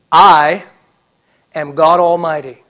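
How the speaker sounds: very loud, emphatic "I", then a pause; preacher proclaiming in God's voice; thundering divine declaration. Acted. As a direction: boom it out as God Almighty. God declares himself, preacher thundering.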